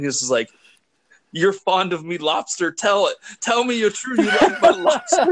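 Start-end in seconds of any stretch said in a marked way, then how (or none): 1.3-5.3 pleading, rising much higher with a laugh breaking through; a wounded old man begging for praise, hammed up; hurt, almost crying. Retelling the film, Defoe begs Pattinson to like his cooking, mock tearful. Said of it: "He's, like, almost crying".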